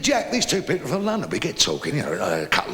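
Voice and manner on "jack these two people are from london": high-pitched wife calling her husband; imitated woman calling.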